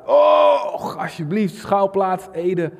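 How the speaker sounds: high, loud plea; God calling out to the Dutch churches, high and loud; pleading shout. Acted. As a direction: cry out on God's behalf. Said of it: "God roept het uit".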